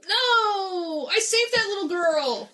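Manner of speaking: loud, high wailed objection; anguished protest. Acted. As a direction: cry out in mock-heroic dismay. Protesting the demon attacking saved girl.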